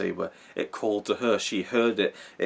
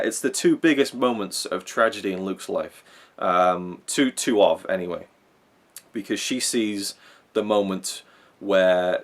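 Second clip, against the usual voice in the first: ordinary delivery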